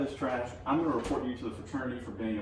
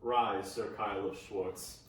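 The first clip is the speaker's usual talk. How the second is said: solemn regal knighting; 6 semitones lower, slower than usual. Acted. Mock knighting ceremony skit.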